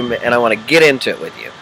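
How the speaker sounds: ordinary talk, nothing marked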